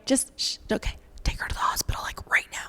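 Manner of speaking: soft whispered instruction; urgent whisper. Retold or imitated. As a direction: hush her, then whisper the order. Doctor hushes, urges hospital trip.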